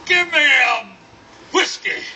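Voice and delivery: high, loud holler across a bar; demanding shout. Acted. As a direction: bellow for service like a huge cowboy. Huge rough man demanding a drink.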